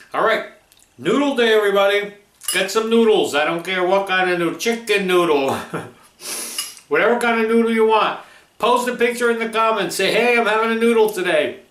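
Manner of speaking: loud, high upbeat sign-off; excited. Real, not acted. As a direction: pump up the audience. Energetic sign-off to viewers.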